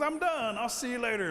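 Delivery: high sing-out goodbye; acted high-pitched exit line; breezy sign-off. Bored bear signs off.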